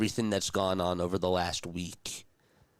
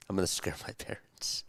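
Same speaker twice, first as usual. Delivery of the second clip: hushed unvoiced whisper, much softer; 6 dB quieter than usual. Real, not acted. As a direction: whisper it like a secret at home. Whispering so parents won't hear.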